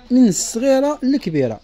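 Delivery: hawking it high and slow; sing-song pitch lift. Shown as a demonstration. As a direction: lift من الصغي�ة للكبيرة up high like a sales pitch, drawn out. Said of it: "same phrase said again".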